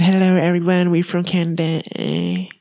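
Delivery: put-on joking as if Canada gave him an English accent; mock English accent. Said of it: "Уже у меня немножечко акцент".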